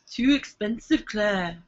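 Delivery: attempted Brummie accent; Birmingham accent. Shown as a demonstration. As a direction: try a put-on Birmingham accent. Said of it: "That's my Birmingham accent".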